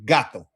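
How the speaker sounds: "gato" with a loud first syllable, GA-to; first-syllable stress. Shown as a demonstration. Teacher demonstrating a stress rule, GA in "gato" punched. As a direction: lean on the ga of "gato". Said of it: "more stress on the beginning portion".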